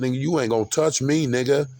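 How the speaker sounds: loud, high defiant shout at the cops; defiant yell. Retold or imitated. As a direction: shout him down, fast and fierce. Defying police at bus station.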